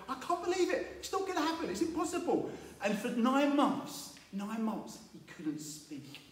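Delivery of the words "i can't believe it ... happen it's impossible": much higher, exasperated disbelief; inner disbelief. Retold or imitated. Zechariah's inner voice doubts, high exasperated disbelief. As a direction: grumble that it can't happen, high. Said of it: "he's going".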